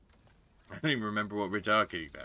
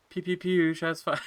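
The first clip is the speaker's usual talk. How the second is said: high loud pew pew; excited gun noises; pew pew sound effects; 9 semitones higher, 5 dB louder than usual. Pretend.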